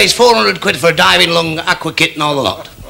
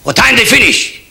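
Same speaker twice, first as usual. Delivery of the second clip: Kosygin voice; 8 semitones higher than usual. Acted. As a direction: demand it in a Russian leader's voice. Kosygin asking.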